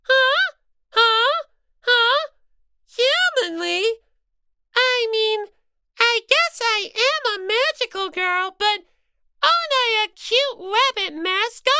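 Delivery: high-pitched flustered rabbit mascot; Monomi voice. Acted.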